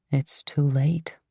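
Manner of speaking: ordinary delivery, nothing marked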